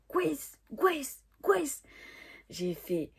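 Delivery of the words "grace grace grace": high mock call through a door; imitating the boyfriend. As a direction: call the mispronounced name in his high voice. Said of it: "il parlait comme ça". (imitation du copain, nom mal prononcé)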